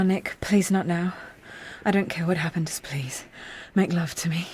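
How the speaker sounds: soft, breathy whispered plea; soft whisper, pleading; hushed plea. Acted. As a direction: beg him gently, almost whispering. Intimate plea in the hut.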